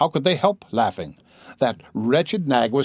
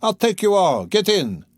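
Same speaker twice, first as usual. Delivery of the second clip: put-on drunken shout; 7 semitones higher than usual. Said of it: "shouted one of them".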